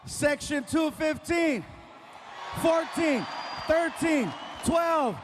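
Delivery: loud roll-call shout to the crowd. Real, not acted. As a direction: holler each number out to the back rows. Calling out to arena sections.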